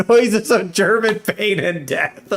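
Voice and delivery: barely getting it out for laughing. Real, not acted. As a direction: read it out through laughter. Laughing at subtitle just read.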